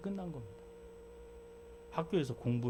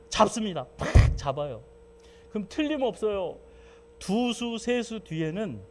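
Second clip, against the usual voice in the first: triumphant; 8 semitones higher, 8 dB louder than usual. Retold or imitated. Triumphant chess story climax.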